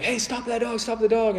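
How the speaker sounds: high shout to stop the dog; urgent call. Retold or imitated. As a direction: yell after the running dog. Man shouts as dog runs.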